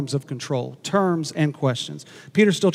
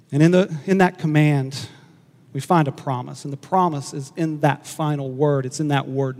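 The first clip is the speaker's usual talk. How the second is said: ordinary talk, nothing marked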